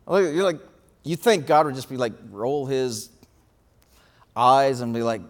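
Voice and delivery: usual speaking voice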